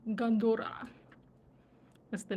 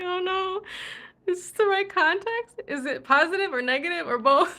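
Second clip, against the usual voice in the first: high, loud, laughing worry; retold oh-no, pitched up and loud; amused mock alarm; 8 semitones higher, 8 dB louder than usual. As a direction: call it out in comic dismay. Retells own doubt, laughing, louder, higher.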